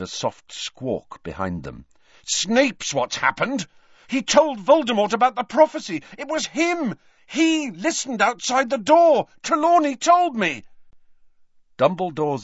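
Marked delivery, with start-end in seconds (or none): 2.3-10.6 high, loud accusing outburst, building; furious. Acted. Harry accusing Snape to Dumbledore, furious rising shout. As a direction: let fury climb into a shout. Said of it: "the spark that ignited Harry's fury".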